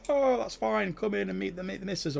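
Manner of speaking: mock the farmer being all cheery; a lightly put-on jolly host; welcoming farmer. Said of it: "He's not like".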